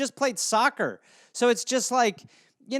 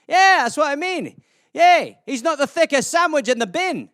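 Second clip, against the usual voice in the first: animated, higher-pitched Scottish banter; hammed-up Scottish lilt; Scottish accent; 5 semitones higher, 3 dB louder than usual. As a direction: play a chatty Scot, pitched up. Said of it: "it's a Scottish euphemism".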